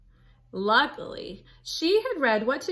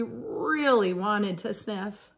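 ordinary delivery, nothing marked

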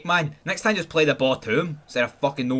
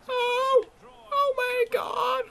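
exasperated wail; 4 dB quieter, much slower than usual. Real, not acted. Reacting to the miss.

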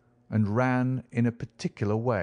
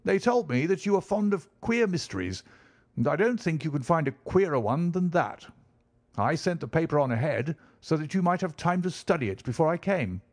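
higher, eager visitor's voice; eager country gentleman; 7 semitones higher than usual. Acted. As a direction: call out keenly as the ruddy squire. Visitor's voice held.

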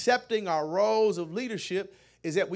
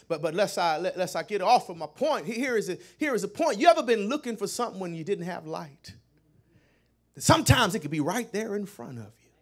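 ordinary delivery

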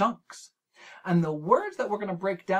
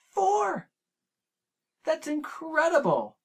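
much higher, bright with excitement; delighted; 12 semitones higher than usual. Real, not acted. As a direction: cheer the count like it's amazing. Delighted teacher praise.

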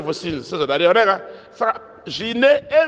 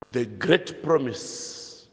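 "the great promise" very slow, low and softer; slow dictation; 7 semitones lower, 6 dB quieter, much slower than usual. Real, not acted. Teacher giving learners the great promise to write, slow dictation. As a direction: stretch "the great promise" out for writing down.

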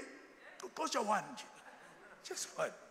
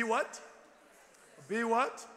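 prompting question; 5 dB louder, much slower than usual. Real, not acted. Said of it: "prompts congregation to complete verse".

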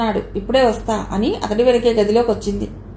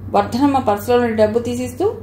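usual speaking voice

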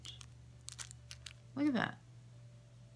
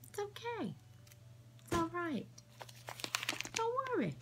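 much higher and softer, cooing comfort; mock soothing; 9 semitones higher, 8 dB quieter, faster than usual. Teasing New Yorkers about their accent, mock cooing reassurance. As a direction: coo it like calming a child, jokingly.

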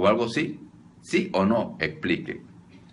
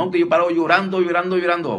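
high, loud plaintive mock lament; mild mock weeping complaint, higher and louder; whiny complainer; 7 semitones higher, 5 dB louder than usual. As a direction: play the tearful parishioner, whining it out. Preacher mimics a tearful complainer.

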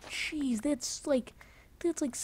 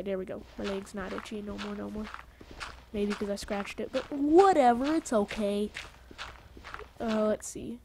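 ordinary talk, nothing marked

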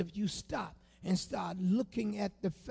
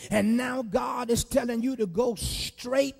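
higher, louder, slow shouted preaching; full-force preaching; 7 semitones higher, 12 dB louder than usual. Acted. Preacher rousing the congregation against self-pity, shouting. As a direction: whoop it up like a fired-up preacher.

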